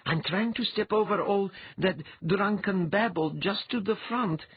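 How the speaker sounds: high-pitched character voice, concentrating; young woman mind-reader, preoccupied. Acted. She tells Undine what she is trying to do, preoccupied. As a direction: pick through the noise in a high, even voice.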